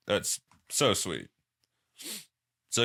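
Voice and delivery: normal talking voice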